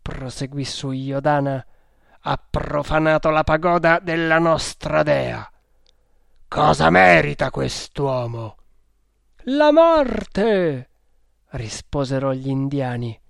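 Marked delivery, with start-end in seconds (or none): 2.2-8.6 loud, declamatory indictment; accusing cult leader. A narrator's reading. Cult leader accuses, asks for verdict. 9.4-10.9 high, loud chanted cry; fierce raised-pitch shout; crowd verdict. A narrator's reading. Followers answer in chorus.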